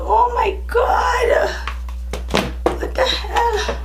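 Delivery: squeaky whispered voice breaking; voice cracking. Real, not acted. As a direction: voice cracking into laughter. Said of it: "I have no voice now".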